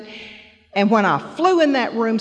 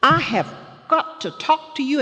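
angry; 7 semitones higher than usual. Retold or imitated. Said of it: "then I got mad again for an instant".

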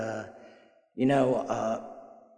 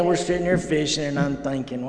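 ordinary talk

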